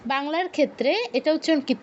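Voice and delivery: normal talking voice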